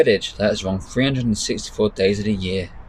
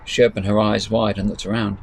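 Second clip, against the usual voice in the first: ordinary delivery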